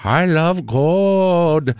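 slow drawn-out Dutch villain voice; Goldmember voice. Retold or imitated. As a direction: savor each word like a greedy villain. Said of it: "it's got the Dutch character".

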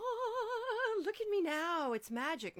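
high, amazed mock wonder; mock amazement. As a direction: gasp at a magic makeover. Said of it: "it's not like you just go".

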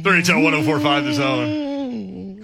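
slow announcer read; radio station ID. Acted.

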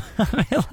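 a short high laugh. Real, not acted. Host laughing at the weather balloon claim. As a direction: burst out laughing. Laughs after Rubio quote.

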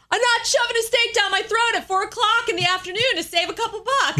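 a high, fast, indignant rant through a laugh; a quick exasperated complaint; Seinfeld quote. Retold or imitated. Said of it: "Remember, uh, Seinfeld?".

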